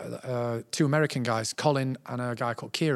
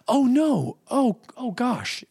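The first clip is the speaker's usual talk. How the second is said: concerned dismay; 9 semitones higher, much slower than usual. Retold or imitated. Tom Hanks reacts with concern.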